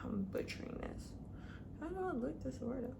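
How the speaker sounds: embarrassed mutter under breath; whispered aside. Real, not acted. To herself while struggling with the word, whispered. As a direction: drop to a whisper, frustrated at herself.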